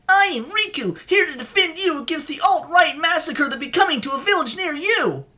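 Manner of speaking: very high, heroic anime hero shout; overacted anime dub. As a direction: ham it up like a dubbed anime hero. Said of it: "sounds like an anime character".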